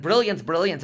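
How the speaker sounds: a little cheer from the nurse; excited. Retold or imitated. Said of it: "in her excitement".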